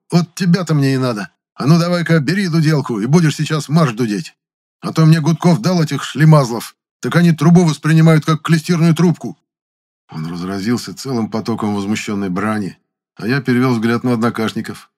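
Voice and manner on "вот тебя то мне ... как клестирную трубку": loud, high, exasperated ranting; loud excited rant. A narrator's reading. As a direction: rant with eager outrage. Zyozik rants at new players. (громкое возмущённое восклицание)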